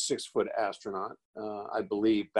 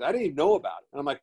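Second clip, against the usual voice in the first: ordinary delivery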